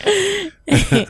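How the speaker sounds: very high squeaky giggle; cartoon laugh.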